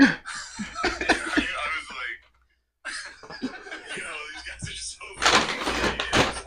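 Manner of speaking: loud laughing retelling. Real, not acted. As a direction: laugh through the quote.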